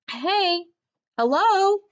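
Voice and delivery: high, loud call over the fence. Acted. Dog calling through the fence slats to the park dogs, loud. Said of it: "I call".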